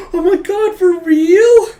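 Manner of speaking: very high, loud fangirl shriek; over-the-top schoolgirl excitement; ecstatic squeal. Acted. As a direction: squeal with thrilled disbelief. Becky reacts, thrilled, in retelling.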